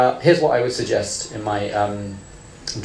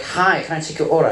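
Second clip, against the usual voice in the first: a chirpy imitation of an American cashier; lightly put-on American server voice; American accent; 4 semitones higher than usual. An American fast-food cashier greeting a customer, in a mock American accent. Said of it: "If you're in America".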